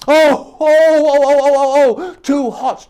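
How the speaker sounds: high, loud cry of pain at hot porridge. Acted. Goldilocks burning her mouth, a high loud cry. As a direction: squeal it out way up high. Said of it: "she cried".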